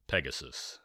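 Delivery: ordinary delivery, nothing marked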